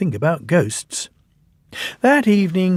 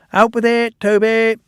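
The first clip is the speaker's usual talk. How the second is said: raised, hurrying demand; impatient interruption; 9 semitones higher, 4 dB louder than usual. A narrator's reading. Thomas impatiently cutting off Toby's news. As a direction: cut him off, impatient. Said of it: "Thomas interrupted".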